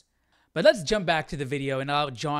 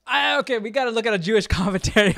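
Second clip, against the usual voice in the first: laughing excitedly; 4 semitones higher, 8 dB louder, much faster than usual. Real, not acted. Reacting excitedly to the clip.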